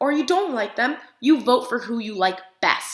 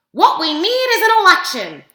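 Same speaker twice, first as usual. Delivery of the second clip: higher, louder indignant duck; Duck's voice; 7 semitones higher, 7 dB louder than usual. A narrator's reading. Reading Duck's grumbling thought aloud to kids, higher and louder. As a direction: protest like a fed-up duck.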